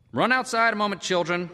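put-on high, lifted call to the children; father sending children off. A narrator's reading. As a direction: shoo the kids out.

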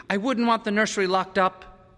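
put-on cool, high-pitched boy's warning; icy young son; cold. A narrator's reading. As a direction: state it coldly to the father. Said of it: "said Peter coldly".

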